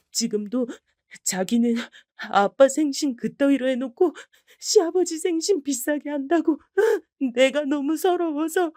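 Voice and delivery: high, aggrieved crying; wronged daughter pouring it out; tearful. Acted. (딸이 아빠에게 서럽게 울며 말함)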